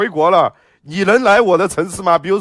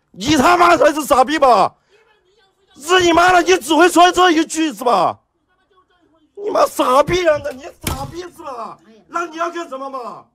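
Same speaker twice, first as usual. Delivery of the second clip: furious shouting; 5 semitones higher than usual. Real, not acted. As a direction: scream curses back at the neighbor. Real shouting match with a neighbor. (跟邻居对骂，怒吼)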